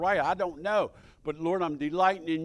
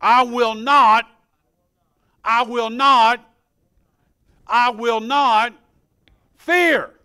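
loud, high, slow chant, each line punched; leading a confession; 5 semitones higher, 11 dB louder, slower than usual. Shown as a demonstration. Preacher leads the congregation in confession, loud and building. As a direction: lead the church line by line, building to a cry on fear. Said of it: "Say it with me".

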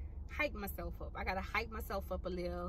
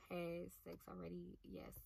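unvoiced, much softer whisper of the words; 2 semitones lower, 12 dB quieter than usual. Real, not acted. Admitting to her viewers she has had sex, whispered. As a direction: whisper it like a secret.

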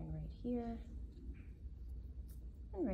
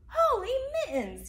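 high, loud exclamation; shocked yell; 10 dB louder than usual. Acted. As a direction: cry out in dismay. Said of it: "yelled Missus Folly".

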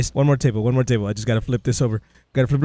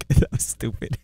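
laughing; 7 semitones higher than usual. Real, not acted.

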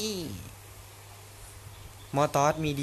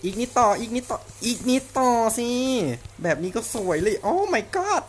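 excited; 8 semitones higher, 5 dB louder, slower than usual. Real, not acted. Cheering a close fight.

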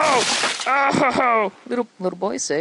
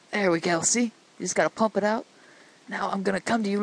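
normal talking voice

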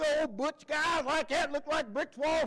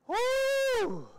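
a high crowd whoop, much higher; high cheering woo; 12 semitones higher, 3 dB louder than usual. Retold or imitated. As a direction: cheer it like a fan in the stands.

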